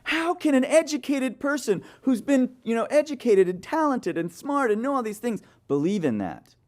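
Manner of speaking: high, mock-indignant voice turned back on her; mocking retort, copying her pitch; mirroring her indignation. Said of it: "I looked at her and I said".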